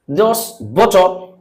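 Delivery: slow, put-on American English with silent r; American accent. Shown as a demonstration. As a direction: try an American "butter" with no final r. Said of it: "American लोग जो है".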